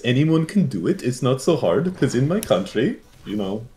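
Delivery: put-on lilting Norwegian accent; sing-song mock Norwegian accent; Canute's accent. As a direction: imitate a Norwegian speaking English. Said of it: "talk like Canute like this".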